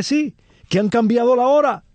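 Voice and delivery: high, animated retelling of a relative's quip; amused exclamation. Retold or imitated. Lecturer retelling a relative's joke to the audience, amused exclamation. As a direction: pitch up high as for a punchline.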